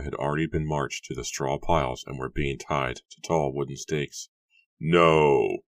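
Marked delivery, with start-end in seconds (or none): 4.8-5.6 loud, drawn-out cry; scream of protest. A narrator's reading. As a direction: howl your protest, narrated with some restraint. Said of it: "William's scream of protest raged".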